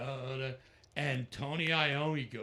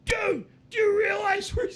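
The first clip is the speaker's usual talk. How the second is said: very high, loud, near-crying shriek; fanboy squeal; 13 dB louder than usual. Retold or imitated. Matt grabbing his arm, shrieking overwhelmed. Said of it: "going like".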